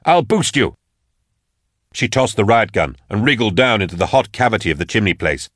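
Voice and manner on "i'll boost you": high, urgent urging; yelled orders. A narrator's reading. Drusha urges Germaine up the chimney first, urgent yelling. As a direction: hurry her along at a raised pitch. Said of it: "he yelled at her".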